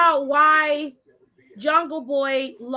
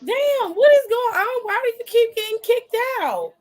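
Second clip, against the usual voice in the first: exasperated; 9 semitones higher than usual. Real, not acted. Guest keeps dropping off.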